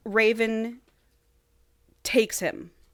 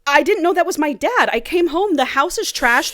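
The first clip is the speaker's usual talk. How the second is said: mock panic; 6 semitones higher, 5 dB louder than usual. Joey panicking at hospital.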